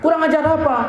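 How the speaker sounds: high-pitched retort; mock protesting child, high; indignant son. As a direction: imitate a boy arguing back at dad. Son argues back.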